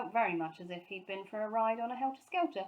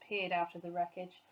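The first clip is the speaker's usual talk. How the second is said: ordinary delivery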